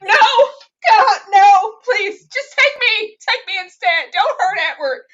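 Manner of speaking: desperate high, loud pleading, hammed up; mock-sobbing shout begging for a loved one; Bella. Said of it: "and Bella's like".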